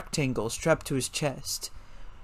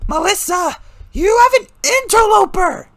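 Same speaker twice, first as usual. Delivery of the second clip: alarmed shout; 9 dB louder than usual. A narrator's reading. Kevin's angry announcement.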